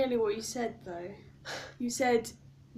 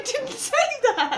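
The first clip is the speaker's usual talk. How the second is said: laughing; 11 semitones higher, 3 dB louder than usual. Real, not acted. Laughing accusation.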